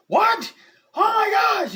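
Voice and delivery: high, loud, shocked cry; panicked shriek. Retold or imitated. Wife hears on the phone that her husband is hurt, panicked shriek. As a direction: shriek it like your husband just got hurt.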